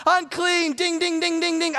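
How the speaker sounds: high, ringing calls of unclean; high leper's warning call. Retold or imitated. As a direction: call out and ring the bell with your voice. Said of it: "shout out at the top of their lungs".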